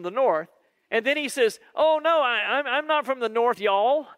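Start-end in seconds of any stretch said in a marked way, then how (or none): 1.7-4.1 fake Southern accent. Peter denying he is from the north, fake Southern accent. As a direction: overdo a Southern accent to hide where you're from. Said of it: "I can hear your northern accent".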